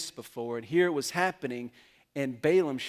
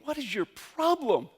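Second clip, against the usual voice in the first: high, slow, exasperated demand; angry rider shout; 10 semitones higher, 4 dB louder than usual. Retold or imitated. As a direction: snap at the stubborn donkey, much higher and drawn out. Balaam beating the donkey.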